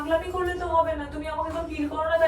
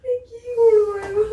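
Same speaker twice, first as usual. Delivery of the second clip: high, loud, slow wail; drawn-out anguished cry; 6 semitones higher, 8 dB louder, slower than usual. Real, not acted. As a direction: wail it helplessly. Wife cries on hearing of divorce.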